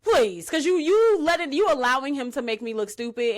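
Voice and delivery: loud, high, fed-up pleading; exasperated scolding. Pretend. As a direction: scold her like you're fed up. Scolding a friend over loyalty.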